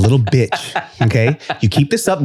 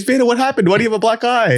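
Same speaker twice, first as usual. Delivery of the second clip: high-pitched feigned alarm; mock concerned parent; 8 semitones higher than usual. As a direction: fuss over a child in a high voice. Pretend concerned parent questioning child.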